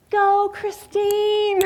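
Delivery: slow, high, drawn-out cheer; cheering yell. Retold or imitated. Daughter cheers her mother down the slope, loud and slow. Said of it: "I could hear my daughter yelling".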